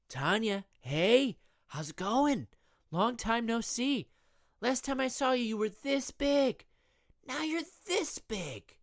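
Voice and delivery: high, slow doting uncle; cheesy uncle voice. Acted. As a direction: talk down to a kid. Uncle greets little niece.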